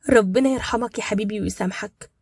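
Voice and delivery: ordinary delivery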